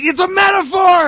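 high-pitched retold shout; exasperated yell. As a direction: yell it as if nobody gets it. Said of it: "I just start yelling".

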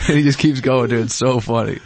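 usual speaking voice

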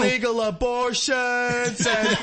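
slow, stretched-out put-on delivery; hammed-up confession with laughter; mock comic confession. Joins mock clean-comic confessions.

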